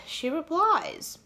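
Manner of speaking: high, drawn-out suspenseful reveal; dramatic reveal. Shown as a demonstration. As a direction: stretch it out slowly for suspense.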